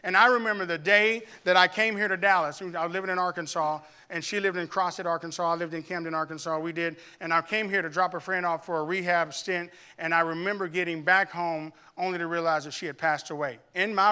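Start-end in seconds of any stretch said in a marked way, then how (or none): none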